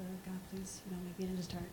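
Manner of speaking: quiet, hushed plea to God; whispered prayer. Retold or imitated. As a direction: pray under your breath. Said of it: "I said".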